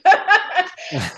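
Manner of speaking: a high, bright burst of laughter; laughing as the lesson lands; real laugh. Real, not acted. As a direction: let a laugh break out. Laughs after the story's point.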